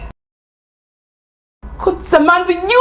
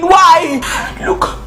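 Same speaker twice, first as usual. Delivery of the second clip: ordinary talk, nothing marked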